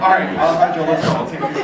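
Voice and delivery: loud, laughing give-in; laughing surrender. Retold or imitated. Roommate gives in to the hungry friend, laughing loudly. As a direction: cave in through laughter.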